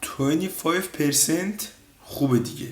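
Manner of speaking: drawn-out imitation of the businessman; slow mock quote of a film line; slow mocking repeat. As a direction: stretch it out slowly, teasing.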